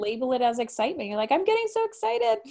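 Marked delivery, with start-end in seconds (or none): 1.3-2.4 mock excited.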